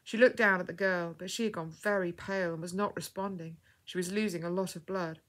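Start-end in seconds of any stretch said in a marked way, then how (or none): none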